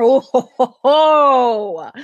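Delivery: loud gloating ho ho ho; evil laugh. Acted. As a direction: chuckle like a villain savoring revenge. Gloating over a mother's revenge.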